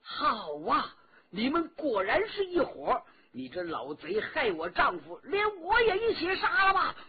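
furious wronged wife; angry scolding. A narrator's reading. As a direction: curse them, building to a desperate cry. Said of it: "骂了起来".

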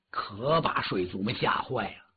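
plain reading voice

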